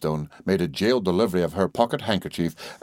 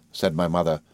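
ordinary delivery, nothing marked

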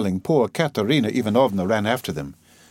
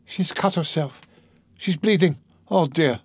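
higher-pitched, distressed exclamation; a touch of alarm, pitch raised; cried in dismay; 7 semitones higher than usual. A narrator's reading. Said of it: "cried Sonia".

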